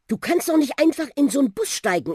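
put-on high-pitched boy's protest; objecting. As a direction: protest in disbelief. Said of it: "erhob Ben Einspruch". (empörter Einspruch)

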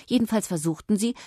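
plain reading voice

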